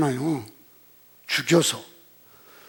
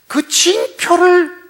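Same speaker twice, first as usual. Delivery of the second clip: high, loud, drawn-out call; insistent demand; 12 semitones higher, 7 dB louder, much slower than usual. Retold or imitated. Gideon asking the angel for a sign, insistent demand.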